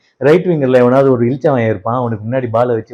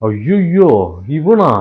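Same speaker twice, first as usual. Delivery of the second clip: frightened; 3 semitones higher, 5 dB louder, much slower than usual. Acted. Man spotting someone he dreads, frightened. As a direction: recoil in mock fright. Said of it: "ஏன் பயந்து போறீங்க?".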